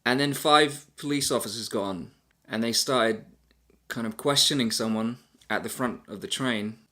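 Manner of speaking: usual speaking voice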